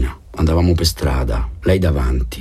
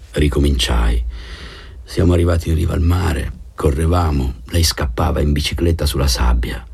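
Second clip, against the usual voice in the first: ordinary delivery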